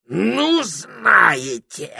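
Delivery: high, loud parrot shriek; furious yell. A narrator's reading. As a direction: scream it with fierce indignation. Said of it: "С таким ожесточением завопил попугай".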